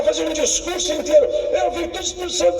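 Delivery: usual speaking voice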